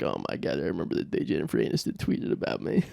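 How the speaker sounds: soft, low, breathy reminiscing; wistful whisper. Retold or imitated. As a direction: whisper it like a fond memory. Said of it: "he's like".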